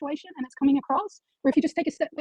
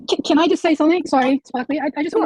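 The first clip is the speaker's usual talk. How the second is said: cutting in loudly, pushing to be heard; loud interruption; 2 semitones higher, 10 dB louder than usual. Real, not acted. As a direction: raise your voice to break into the talk. Cuts in to get heard.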